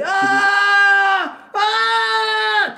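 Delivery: high, drawn-out, loud dying cry; dying gasp. Shown as a demonstration. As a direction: groan out a long dying ah. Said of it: "Vraiment, t'es en train de mourir".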